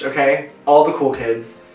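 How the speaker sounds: normal talking voice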